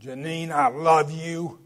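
loud, drawn-out, overdone declaration of love; wrong-toned I love you. Shown as a demonstration. Preacher showing how tone spoils words to his wife, loud insincere declaration. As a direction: call out the love words loudly and slowly, not tenderly. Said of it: "The tone of the way we speak".

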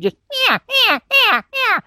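high loud yeah yeah cow mew; elk cow call. Shown as a demonstration. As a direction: voice the cow call with the mouth reed. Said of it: "I'm making that cow sound".

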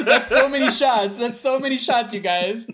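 cracking up while talking; laughing. Real, not acted. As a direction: squeal it out between laughs. Cracking up at a joke.

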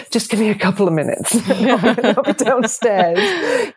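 retold reply broken up by laughter; amused reluctance. Parent retells answering a late-night teenager, laughing. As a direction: laugh through the words, dragging out the last ones.

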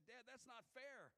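put-on high, slow childish whine; whiny little kid. Son complaining to his dad, mock childish whine.

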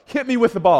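loud, high coaxing call; mock-eager dad shouting encouragement; urging call. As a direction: dare him to throw it, loudly. Dad urging son to throw.

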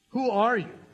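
Who are you? pushed out with force; pressing question. Shown as a demonstration. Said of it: "Who do you say that you are?".

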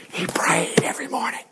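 hushed secret whisper. Acted. Preacher whispering a secret to the congregation.

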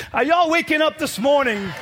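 loud, high, driving preacher's cry; preaching at full force. Acted. Preacher urging the congregation to tell God they're angry, preached loud and high. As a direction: lift the room, preach it hard and loud.

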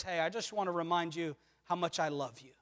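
soft, low, tender reminder; gentle reassurance. Acted. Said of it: "No, he just comes to us constantly".

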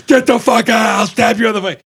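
loud, high-pitched menacing shout; shouted threat. Retold or imitated. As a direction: yell it to drive him off. Brother threatening the kid.

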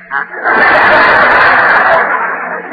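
real burst of loud laughter; host breaking up at the joke; big hearty laugh. Real, not acted.

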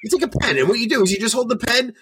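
high-pitched, animated mock instructor; imitating Simon teaching. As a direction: act out an eager how-to demonstration. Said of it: "He's like".

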